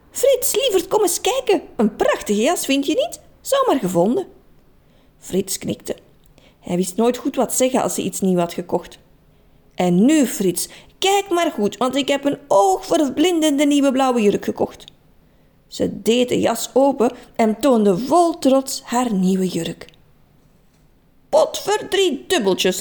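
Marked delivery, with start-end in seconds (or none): 0.1-4.3 high, eager call to her husband; excited calling out. Acted. Trudy calls her husband over. 9.7-14.8 proud. Acted. Trudy proudly shows the dress. 21.3-22.8 exclaimed. Acted. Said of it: "riep Frits".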